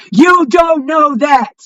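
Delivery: slow, shouted, each word hammered; angry shout. Real, not acted. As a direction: yell it out slowly at them. Angry rant at fake friends.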